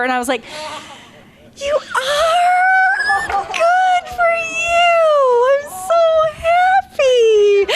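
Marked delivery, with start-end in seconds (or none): 1.6-7.7 high, very slow tearful squeal of joy, half laughing; happy crying. Retold or imitated. Congratulating a friend who got Ginger, through happy tears. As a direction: sob out your congratulations, high and stretched.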